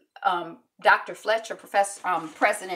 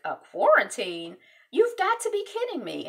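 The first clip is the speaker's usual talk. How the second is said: disbelief; 6 semitones higher than usual. Retold or imitated. President hears quarantine news.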